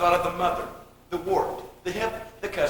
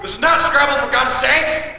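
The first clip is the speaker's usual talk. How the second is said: exasperated outburst; 3 semitones higher, 4 dB louder than usual. Acted. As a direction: cut off the silly game in exasperation. Cuts off the bickering word list.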